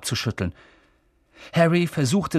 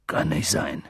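lower, mildly shaken Hagrid; trembling voice; 10 semitones lower than usual. Acted. Hagrid denying it, with a mild tremble. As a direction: let the words tremble a touch. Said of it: "mit zitternder Stimme".

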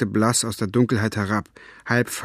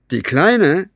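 louder and higher, incredulous; disbelieving; 7 semitones higher, 6 dB louder than usual. A narrator's reading. Capricorn doubting the girl did it, loud and incredulous. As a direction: throw the question out in disbelief. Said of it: "Capricorns Stimme klang ungläubig".

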